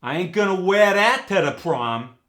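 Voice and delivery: New York "air" turned to "eh-uh" in WHERE; New York accent. Shown as a demonstration. New Yorker refusing a prom outfit, in New York accent. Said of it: "But in the New York dialect".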